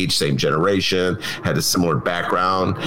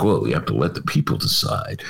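mock Mitch McConnell; 11 semitones lower than usual. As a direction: pitch it down to mock the senator. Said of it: "Mitch is like".

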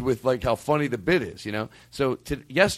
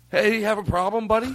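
higher-pitched mock confrontation; imitated challenge; 8 semitones higher than usual. Host imitating comedian in locker room, higher mock challenge. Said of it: "he goes".

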